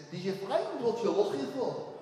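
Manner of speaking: slow, mock Scouse impression; comic thick Scouse question; Liverpool accent. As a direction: imitate an old Liverpudlian's accent. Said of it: "he said in his terrible accent".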